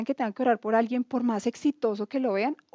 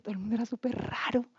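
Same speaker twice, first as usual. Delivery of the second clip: softer whispered confidence; whispered aside; 7 dB quieter, faster than usual. Real, not acted. Woman telling her audience about Bible class, whispering. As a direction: lean in and whisper it.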